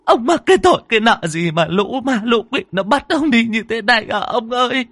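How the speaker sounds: high, loud keening wail; wailing lament. Acted. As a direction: wail over her husband's coffin. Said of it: "miệng lại gào lên khóc". (gào khóc thảm thiết)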